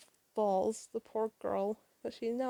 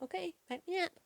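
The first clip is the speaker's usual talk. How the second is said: eager sidekick; 8 semitones higher than usual. Acted. Tails voiced, eager reply to Sonic.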